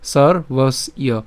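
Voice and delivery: "সর বস ইয়" in three separate pieces, sor, bos, iyo; slow model of "সর বস ইয়" with breaks between; syllable breakdown. Shown as a demonstration. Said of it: "একটু দেখাই".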